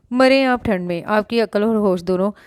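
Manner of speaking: ordinary delivery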